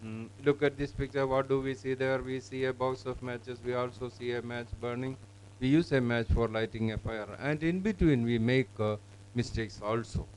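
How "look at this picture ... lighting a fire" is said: soft monotone, sentences run together without pauses; level, unstressed read-aloud, barely a break; bad monotone reading. Shown as a demonstration. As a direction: rattle it off like a pupil reading mechanically. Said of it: "I have seen it even to this extent".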